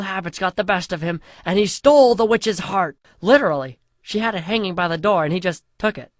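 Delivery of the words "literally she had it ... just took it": plain reading voice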